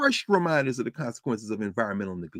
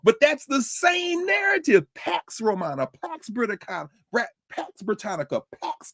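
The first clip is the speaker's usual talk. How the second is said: exasperated outburst; 11 semitones higher than usual. Real, not acted.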